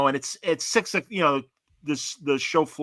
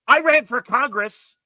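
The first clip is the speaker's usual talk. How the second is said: high, loud, slow mock proclamation; indignant boast; 10 semitones higher, 8 dB louder, slower than usual. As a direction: imitate a self-important man pulling rank, slowly and loudly. Retelling stranger's angry boast.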